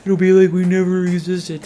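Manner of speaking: mock-solemn goodbye; mock brooding vampire. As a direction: overdo it like a melodramatic vampire boyfriend. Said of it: "that's some fucking Edward shit".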